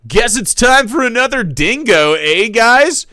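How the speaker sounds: cheery streamer voice. Acted. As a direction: toss it to chat with fake cheer. Jake addressing his stream.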